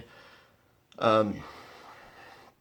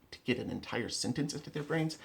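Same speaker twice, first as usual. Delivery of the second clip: ordinary talk, nothing marked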